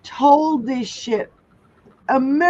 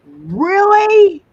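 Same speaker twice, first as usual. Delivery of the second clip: much higher and louder, incredulous; outraged disbelief; 8 semitones higher, 9 dB louder than usual. Real, not acted. Reacting to a felon becoming president, loud incredulous question.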